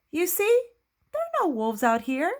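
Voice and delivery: high-pitched, told-you-so pig sister. Acted. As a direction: prove your point in a high character voice. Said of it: "Sister Pig said".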